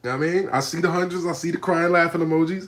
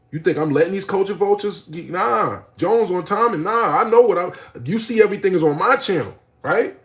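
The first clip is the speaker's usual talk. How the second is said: heated; 7 semitones higher, 6 dB louder than usual. Retold or imitated. Retold heated words.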